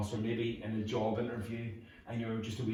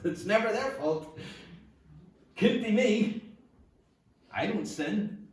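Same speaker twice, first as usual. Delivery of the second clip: high, loud, mocking holier-than-thou voice; 9 semitones higher, 4 dB louder, slower than usual. Preacher mocks blame-shifting churchgoers in a smug, high voice. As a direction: parody a believer who thinks he can't sin. Mocking self-righteous Christians.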